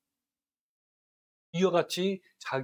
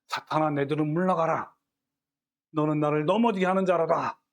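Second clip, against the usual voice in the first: stern rebuke; 5 dB louder than usual. Acted. Jesus rebukes Peter.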